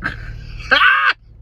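high, loud cry of a laugh; shriek-laugh at your own embarrassment; embarrassed shriek laugh. Real, not acted. Laughing at herself over the untucked shirt, a shrieking cackle.

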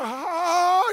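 high, drawn-out wailing laugh; hysterical fear laugh. Retold or imitated. Preacher imitates his deathly afraid wife, hysterical laughing wail. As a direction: imitate someone laughing from terror. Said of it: "she begins to laugh hysterically".

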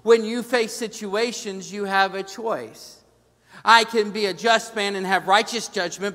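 high, loud preaching drive; preaching at full force. Acted. Pastor drives his point home to the congregation, loud and high. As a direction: preach it out to the pews.

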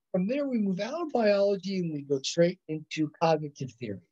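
ordinary delivery

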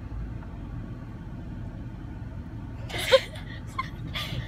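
high breathy laughing fit; burst of laughter. Real, not acted. Laughs at lonely eaters.

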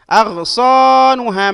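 loud, high, slow "أغصانها"; drawn-out long vowels. Shown as a demonstration. Teacher analysing the word for students, drawn-out long vowels. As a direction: stretch "صا" and "ها" in "أغصانها". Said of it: "مد مع ممدود".

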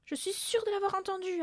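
high-pitched certainty; earnest insistence. A narrator's reading. Mathilda backing up her mother, high insistence. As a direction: back the claim up eagerly. Girl backs up frightened mother.

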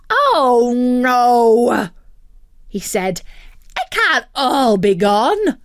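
a greedy bird finding the chocolate gone; dismayed turkey. Acted. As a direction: panicky little turkey. Tick-Tock Turkey dismayed.

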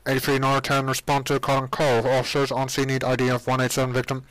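flat dispatcher over a crackling radio; police radio call. Acted. Dispatcher radios Sonny about a homicide, radio voice. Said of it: "Your radio crackles".

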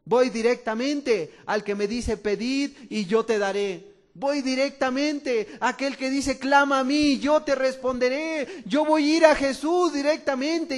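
rising, loud preaching build; fervent preacher building up; full-force preaching. Acted.

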